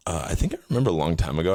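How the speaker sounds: ordinary talk, nothing marked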